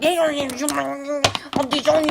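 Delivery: hammed-up dad yelling, high and loud; mock angry father. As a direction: play the furious cartoon father shouting his threat. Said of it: "the father comes in and she's all like".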